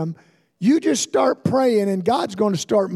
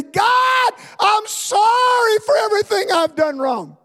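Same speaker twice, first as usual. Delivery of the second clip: crying out to God; 12 semitones higher than usual. Retold or imitated. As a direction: cry it up to heaven like a child repenting. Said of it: "I'm crying out of all sincerity".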